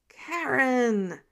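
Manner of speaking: loud exasperated groan of her name. Acted. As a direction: moan her name in frustration. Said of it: "the man groaned".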